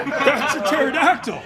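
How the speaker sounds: laughing while talking; words through laughter. Real, not acted. Laughing after impression.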